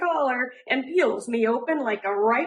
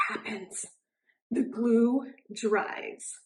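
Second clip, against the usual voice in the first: whispered reveal; 4 dB quieter, slower than usual. A narrator's reading. Dramatic reveal.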